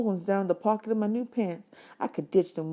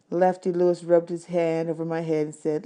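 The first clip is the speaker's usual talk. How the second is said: usual speaking voice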